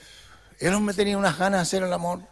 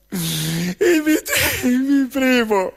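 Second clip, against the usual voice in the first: put-on bashful girl slow; 3 semitones higher, much slower than usual.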